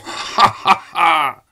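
high, drawn-out cackle; mocking laugh. A narrator's reading. Crowd of the dead laughing at the general, raucous.

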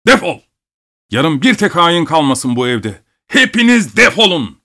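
high-pitched yelled dismissal; angry shout. A narrator's reading. Master shouting after the departing servant, furious. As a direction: yell after him, voice leaping up in rage. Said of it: "arkasından bağırdı".

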